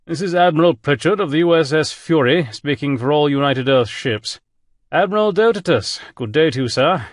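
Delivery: polite English gentleman greeting over the comm; distinguished English accent. Acted. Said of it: "with a distinguished English accent".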